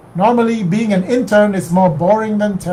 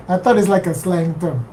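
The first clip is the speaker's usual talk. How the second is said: usual speaking voice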